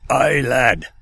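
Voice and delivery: hammed-up Scottish brogue; broad Scottish accent. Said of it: "Thick Scottish accent".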